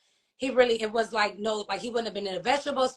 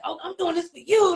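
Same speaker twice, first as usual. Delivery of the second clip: higher, louder, eager comeback; mother answering her son, eager and higher; emphatic reassurance; 7 semitones higher, 7 dB louder than usual. Retold or imitated. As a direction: insist right back to the son. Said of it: "And I'm like".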